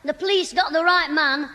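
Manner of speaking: ordinary talk, nothing marked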